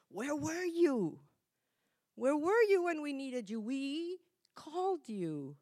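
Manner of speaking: loud, high, slow reproach; outspoken Martha. Acted. Martha confronting Jesus over her dead brother, loud accusing plea. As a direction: demand it, hurt and accusing, drawing each word out. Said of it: "more outspoken".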